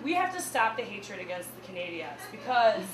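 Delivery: normal talking voice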